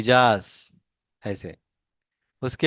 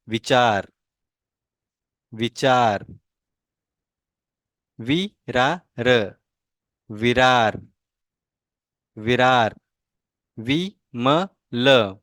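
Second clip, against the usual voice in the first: slow syllable demo. Shown as a demonstration. Teacher reads Vimal to the class slowly, syllable by syllable. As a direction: spell out Vimal slowly as if to children. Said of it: "आप ठीक से ध्यान दो".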